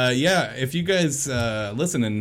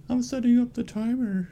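high, whiny mock of his friend's voice; sending up his co-host's way of talking; mocking impression of co-host; 13 semitones higher than usual. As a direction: parrot him in a silly high voice. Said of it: "That's how you sound to me".

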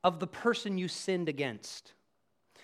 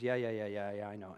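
low, quiet brush-off; dismissive; 10 semitones lower, 7 dB quieter than usual. Retold or imitated. Husband not listening to his wife, dismissive. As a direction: run off the yeahs to end the discussion.

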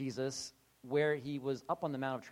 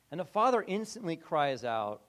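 usual speaking voice

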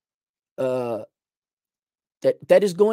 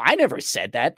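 ordinary talk

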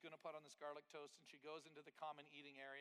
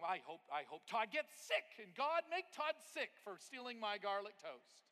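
louder, higher hammed-up curse; mock vengeful prayer; 5 semitones higher, 9 dB louder than usual. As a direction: ham up a petty vengeful prayer. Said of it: "I started thinking".

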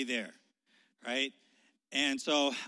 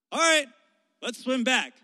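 calling out; 6 semitones higher, 8 dB louder than usual. Retold or imitated. Friend calls from the raft.

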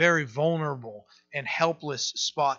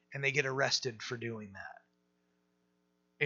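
ordinary talk

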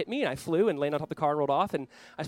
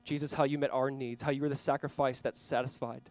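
quiet prayer; 3 semitones lower, 4 dB quieter than usual. Acted. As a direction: pray it softly for the congregation. Leads a prayer.

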